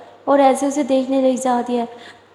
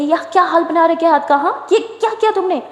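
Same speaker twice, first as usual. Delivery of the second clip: scolding; 5 semitones higher than usual. A narrator's reading. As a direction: scold her for hurting herself. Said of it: "Durga गुस्सा करती है".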